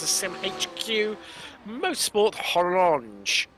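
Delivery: slow, hammed-up French accent. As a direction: say the team name in a playful French accent. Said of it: "My best, uh, French accent there".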